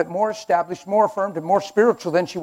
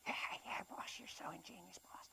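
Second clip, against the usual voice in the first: whispery little yes-man imp; voiceless, sycophantic minion; fawning imp; 27 dB quieter than usual. Acted. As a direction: suck up to the boss in a tiny whisper. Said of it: "the little imp comes over".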